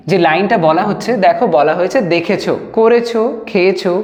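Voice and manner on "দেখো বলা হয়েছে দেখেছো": ordinary delivery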